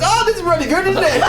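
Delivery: high, laughing mock praise; mocking quote. Teasing a friend by aping his gushing praise. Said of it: "you were like this".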